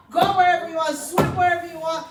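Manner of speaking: high, loud, triumphant; rally the crowd with freedom; rousing proclamation. Real, not acted. Describing her swimwear line to the audience, rousing and loud.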